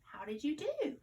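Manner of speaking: ordinary talk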